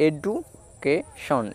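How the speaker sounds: Education drawn out slowly, syllable by syllable; slow demo word. Shown as a demonstration. Teacher blending the syllables for pupils, slowly drawn out. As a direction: stretch the word out so pupils can follow. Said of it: "আমি আরেকবার বলছি".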